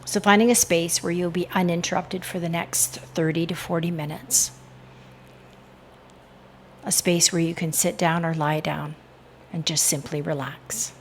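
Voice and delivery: calm, measured hypnotic induction voice; guided meditation. Real, not acted. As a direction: guide the listener into relaxation with unhurried pauses.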